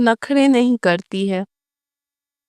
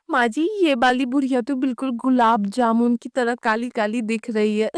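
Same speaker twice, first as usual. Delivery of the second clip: hushed gossip; 2 semitones lower, 7 dB quieter, faster than usual. Acted. Mocking whisper about old woman.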